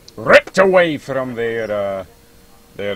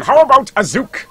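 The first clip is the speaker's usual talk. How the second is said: excited host; 12 semitones higher, 10 dB louder than usual. Real, not acted. Host calling on player.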